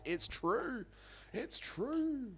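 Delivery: very high squeaky agreement. Pretend. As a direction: chirp it in falsetto.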